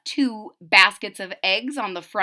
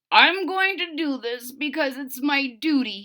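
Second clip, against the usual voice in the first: sick voice; 5 semitones higher than usual. Acted. Sick bunny Wilbur speaks.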